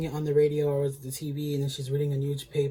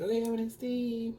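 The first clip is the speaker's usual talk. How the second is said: fake sweet; 8 semitones higher than usual. Stealing purse, fake sweet goodbye.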